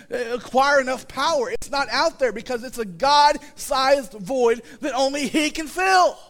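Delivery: rising fervent preaching shout; preaching climax. Real, not acted. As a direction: lift it into the big finish. Preaching builds to peak.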